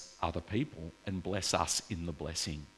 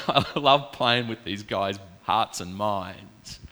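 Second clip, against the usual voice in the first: slow, louder, lightly voiced chuckling God; a mild, amused divine voice, drawn out; playful God voice; 6 dB louder, slower than usual. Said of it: "God's up there just having a good chuckle going".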